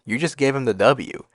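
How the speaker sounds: ordinary talk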